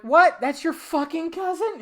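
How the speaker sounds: high-pitched stunned question; disbelieving what. Retold or imitated. Learning Josh is his cousin, high stunned question. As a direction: ask it high in sheer disbelief.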